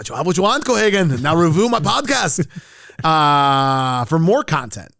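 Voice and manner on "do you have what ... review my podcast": mock Schwarzenegger. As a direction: snarl it like a movie hero. Total Recall quote parody.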